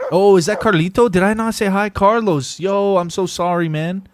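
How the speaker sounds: loud, high burst of delight at spotting a friend; excited greeting. Real, not acted. Streamer spots friend Carlito, loud excited greeting. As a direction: call out to him like a long-lost buddy.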